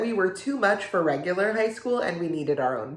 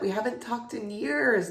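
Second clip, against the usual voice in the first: higher, lively exclamation; eager catching-up; 5 semitones higher than usual. Acted. Catching up with an old school friend, eager. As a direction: say it brightly, in an excited rush of reunion. Excited catching up, then awkward restatement.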